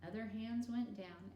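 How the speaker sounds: plain reading voice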